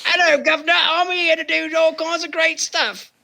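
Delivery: high mock Cockney greeting; chirpy cartoon British urchin voice; South Park English. Cohost joins in a high cartoon British accent. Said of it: "South Park English".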